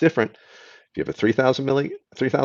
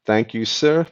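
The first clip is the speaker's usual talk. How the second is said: usual speaking voice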